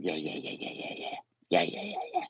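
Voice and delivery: imitating a lady's rapid repeated yeahs, partly voiceless. Medium passing on how a lady used to talk, imitated yeah-yeah. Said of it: "used to say it like that".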